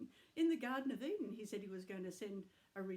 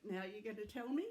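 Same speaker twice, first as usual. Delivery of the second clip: ordinary delivery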